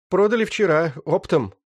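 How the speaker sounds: high-pitched rough bandit; young thug voice. Acted. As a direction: whine it like a wounded gangster. Раненый бандит. (высокий голос молодого бандита)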